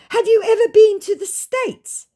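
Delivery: silly high scammer voice; mock scammer voice. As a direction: ham up the scammer's question.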